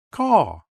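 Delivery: sharp bird caw; crow caw. A narrator's reading. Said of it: "Do you caw too".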